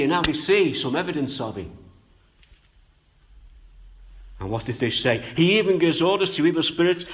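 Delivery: loud, high, fervent pulpit voice; preaching at full force. Acted. Preacher voicing the amazed crowd, loud and fervent.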